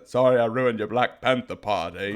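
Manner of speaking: deadpan movie quote to defuse anger; quoting Forrest Gump to cheer someone up. Retold or imitated. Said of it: "from Forrest Gump".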